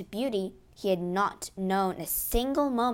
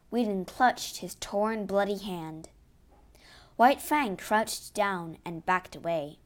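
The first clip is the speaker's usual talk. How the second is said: ordinary delivery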